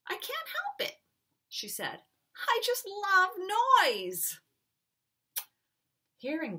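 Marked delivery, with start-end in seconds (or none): 0.1-4.4 a hint of an excited young girl, higher; gleeful child declaring her love of noise; lightly voiced little girl. Carolina telling the people she loves noise, gleeful.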